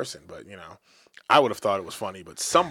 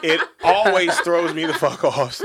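laughing; 3 semitones higher, 6 dB louder, slower than usual. Real, not acted. Laughing at coworker story.

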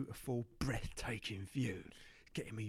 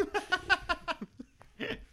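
squealing laugh; 4 dB louder than usual. Real, not acted.

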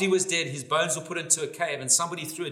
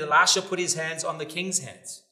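usual speaking voice

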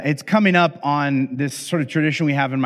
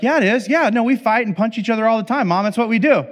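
put-on higher, eager tattling kid voices; cheeky kids; 6 semitones higher than usual. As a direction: kids gleefully outing mom. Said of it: "And they're like".

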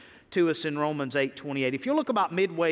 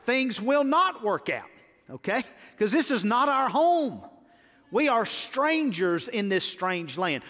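rising preaching; 5 semitones higher than usual. Acted. Pastor to church, insisting this is not our home, rising preaching. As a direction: preach it up, climbing with each denial.

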